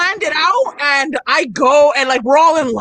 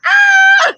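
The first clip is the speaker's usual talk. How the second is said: very high, loud shriek; piercing delighted scream; excited squeal; 6 dB louder than usual. Retold or imitated. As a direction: squeal as if showing off a ring. Acting out squeal for the cameras.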